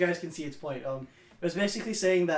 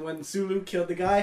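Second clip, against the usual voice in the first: ordinary talk, nothing marked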